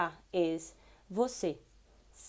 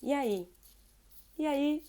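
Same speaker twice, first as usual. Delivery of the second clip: casual greeting; 4 semitones higher than usual. Shown as a demonstration. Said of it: "Just say".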